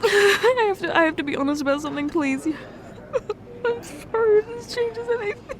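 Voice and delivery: high, broken, tearful voice; crying. Acted. Admitting the truth to her partner, crying. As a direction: fight through the tears to say it.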